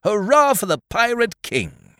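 pirate cheer. Acted. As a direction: cheer it like a rowdy pirate crew. Pirates cheer their king.

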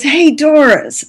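lightly voiced boyfriend teasing her; boyfriend calling her. Boyfriend calling out to her in the story, light teasing.

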